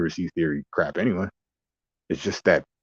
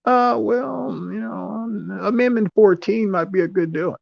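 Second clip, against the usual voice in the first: high, slow put-on voice of a hesitant politician; mock a fumbling old man, pitched way up; high mocking impression, drawn out. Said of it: "He's like".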